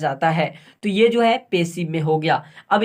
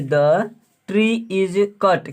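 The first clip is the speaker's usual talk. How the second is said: slow dictation; much slower than usual. Shown as a demonstration. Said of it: "dictating answer slowly".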